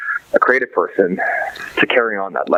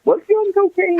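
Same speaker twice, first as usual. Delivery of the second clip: mock incredulous question. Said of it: "they're like".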